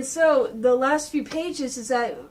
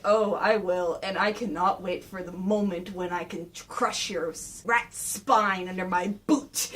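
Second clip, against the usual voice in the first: low, quiet villain menace; menacing Shredder; 6 semitones lower, 3 dB quieter than usual. Retold or imitated. Shredder threatening the rat, low sinister voice. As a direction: relish the threat, low and slowing. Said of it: "Shredder's going like".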